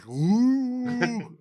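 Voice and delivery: high, drawn-out comic ooh; Bernie's comic ooh. Retold or imitated. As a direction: pop your head out and coo it high. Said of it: "sticks his head through the curtains and goes".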